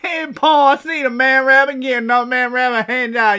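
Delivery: high, loud country holler; excited hillbilly. Acted. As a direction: blurt it to Pa like a yokel kid. Acted hillbilly kid telling Pa.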